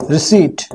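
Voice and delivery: रिसीट said with no p sound, ri-SEET; silent p in receipt. Shown as a demonstration. Teacher to students: रिसीट with the p kept silent. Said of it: "तो इसको रिसीप्ट मत बोलिएगा".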